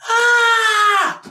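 a sudden high, loud imitated shriek of horror; nurse's scream. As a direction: shriek like you just saw something awful. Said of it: "I heard her scream".

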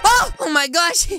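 ordinary talk, nothing marked

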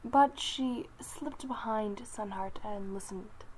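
usual speaking voice

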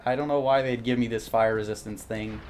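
ordinary talk

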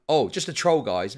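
normal talking voice

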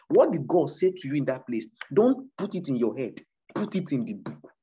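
ordinary talk

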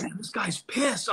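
slow, hushed worried thought; whispered aside. Retold or imitated. Comic noticing his idol's anger, whispered aside. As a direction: whisper it to yourself, slowly.

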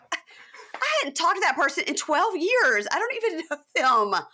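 high, loud, slowed protest, laughing at the end; exasperated. Retold or imitated. Telling Mary Preston she hasn't seen that person in years, high exasperation. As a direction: draw it out in disbelief.

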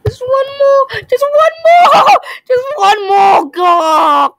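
high, loud desperate screaming. Real, not acted. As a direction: scream at the game. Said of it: "of me screaming".

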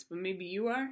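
ordinary talk

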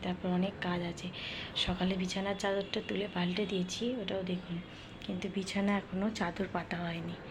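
soft hushed talk, daughter asleep in the next room; speaking softly. Real, not acted. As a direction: keep it low and quiet, almost whispering. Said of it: "এখন আমি আস্তে আস্তে কথা বলছি".

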